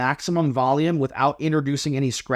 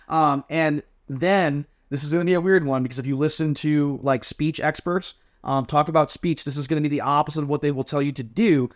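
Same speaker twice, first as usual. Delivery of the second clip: ordinary talk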